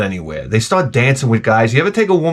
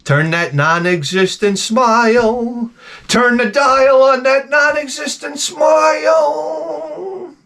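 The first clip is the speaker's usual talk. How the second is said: slow, much higher, building to a shout; slow taunting yell rising in pitch; mocking shout; 11 semitones higher, much slower than usual. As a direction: bellow it at the sourpuss, dragging the words. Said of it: "that's what I'm gonna start saying".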